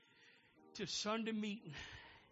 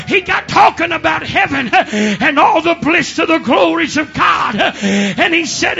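loud, driving sermon delivery, climbing higher; full-force preaching; 6 semitones higher, 24 dB louder than usual. Real, not acted. Preacher telling of the slave in the balcony, full-force preaching. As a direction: build the story in loud, rhythmic gasped phrases.

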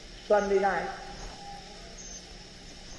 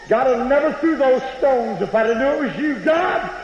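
high, loud pleading cry rising to a wail; desperate dying plea; 9 semitones higher, 5 dB louder than usual. Retold or imitated. Dying woman under the porch begging God, rising desperate wail. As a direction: beg God with mounting terror. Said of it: "The woman was mumbling something".

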